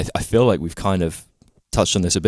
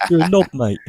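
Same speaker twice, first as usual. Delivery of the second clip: put-on British accent; 9 semitones higher than usual. Mocking British banter.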